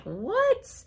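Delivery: high-pitched mock "what?"; incredulous. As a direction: act out a couple's shocked disbelief. Couple balk at dancing, retold.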